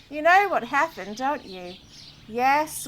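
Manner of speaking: usual speaking voice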